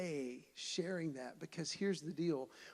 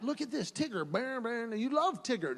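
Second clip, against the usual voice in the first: higher, sing-song coaxing; dad coaxing his toddler toward a toy, higher sing-song; coaxing baby talk; 6 semitones higher than usual. Retold or imitated.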